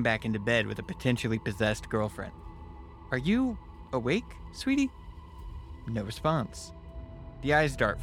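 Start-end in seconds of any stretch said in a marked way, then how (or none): 3.1-4.9 rising tentative sweetie; gently testing if she's awake; cautious high question. Retold or imitated.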